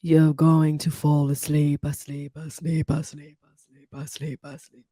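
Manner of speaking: slow British hypnotist, fading away; Glenn Harold. Retold or imitated. Imitating a British hypnotist putting her to sleep, fading British accent. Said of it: "British guy".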